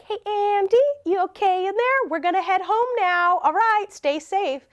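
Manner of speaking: high, singsong check-in; retold high-pitched careful goodbye; imitated calling out. Calling to friend through bathroom door.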